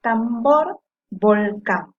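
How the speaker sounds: tambor and volcán, each landing loud on the final syllable; stressed last syllable. Shown as a demonstration. Teacher giving examples of agudas, tambor, volcán with stressed endings. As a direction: hit BOR in tambor and CÁN in volcán. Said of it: "cuando la sílaba tónica es la última".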